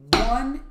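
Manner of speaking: ONE punched loud, then light taps; accented one. Shown as a demonstration. Drum teacher demonstrating the A pattern accent. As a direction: hit the count One hard. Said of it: "sounds like this".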